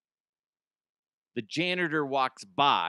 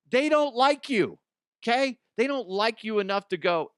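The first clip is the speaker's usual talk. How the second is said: higher, louder emphatic ranting; exasperated rant; 10 semitones higher, 6 dB louder than usual. Real, not acted. Comedian telling the bride off, louder exasperated rant. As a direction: hammer home that they don't like you. Ranting about Monday wedding.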